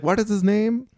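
high put-on doctor voice; imitating the doctor. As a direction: raise the pitch into the doctor's voice. Doctor voice again.

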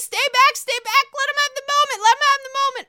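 high, loud stay back; mock urgent order. Giselle holding Wendy back, loud and high. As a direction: hold her back, urgently. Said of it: "Giselle ... says".

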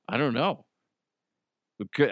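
normal talking voice

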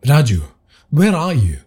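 a mildly urgent call into the dark; urgent question. Acted. Tarek searches the dark room for Raju, mildly urgent. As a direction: call out, worried and pressing. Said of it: "Tarek inquired urgently".

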